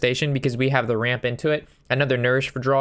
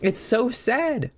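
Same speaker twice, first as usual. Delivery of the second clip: higher, slow pitying sigh; mock pity; 10 semitones higher, much slower than usual. Real, not acted. Streamer pitying clueless players, slow and mocking. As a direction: drag it out in mock sorrow. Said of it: "Sorry for that little rant there".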